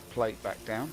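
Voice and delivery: normal talking voice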